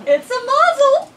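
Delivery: very high, loud exclamation; delighted woman. A narrator's reading. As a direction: squeal it out as a blessing. Mrs Kessler voiced.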